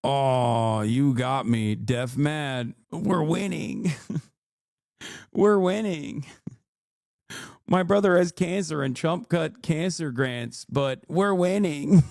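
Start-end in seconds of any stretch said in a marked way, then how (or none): 2.9-6.3 slow, high sarcastic gloating; mock gloat. Streamer mocking fans with sarcastic gloat. 10.7-12.0 slow, higher mock triumph; deadpan irony, stretched out; sarcastic gloat. Streamer mocking fans, slow sarcastic gloat.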